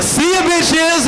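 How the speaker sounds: high, drawn-out mock farewell; triumphant little sister. Comic voices sister walking out victorious, high stretched-out crowing. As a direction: crow it high and slow, like a kid making a cocky exit. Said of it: "walked out like".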